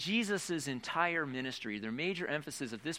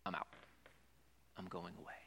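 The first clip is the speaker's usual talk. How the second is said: hushed Jesus line; 9 semitones lower, 15 dB quieter, faster than usual. Acted. Pastor voices Jesus leaving.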